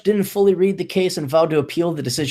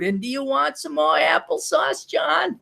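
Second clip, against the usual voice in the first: very high New York mom voice; hammed-up New York mother, pitched up; 10 semitones higher, 3 dB quieter, slower than usual. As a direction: offer food like a doting sitcom mother. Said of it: "this T V show New York mom".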